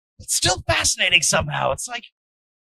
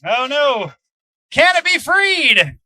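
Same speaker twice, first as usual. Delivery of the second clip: excited, loud fight commentary; live play-by-play; 5 semitones higher, 9 dB louder, slower than usual. Acted. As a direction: call the action as it happens.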